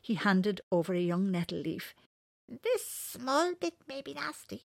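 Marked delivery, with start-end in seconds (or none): 2.5-4.7 high, slow warning bird voice; cartoon bird voice. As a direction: caution him like a little bird. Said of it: "he said".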